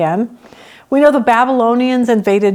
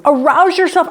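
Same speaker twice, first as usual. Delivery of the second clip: "Arouse yourself" pitched higher and pushed hard; urgent wake-up call; 6 semitones higher than usual. Retold or imitated. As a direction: shout it up at a sleeping God. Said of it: "You're opening the curtain saying".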